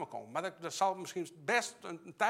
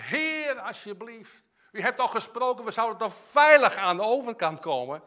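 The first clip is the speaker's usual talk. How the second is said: louder, higher pleading cry to God; retold desperate prayer; 5 semitones higher, 9 dB louder than usual. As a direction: re-enact begging God mid-turbulence. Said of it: "ik heb mijn longen uit mijn lijf geschreeuwd".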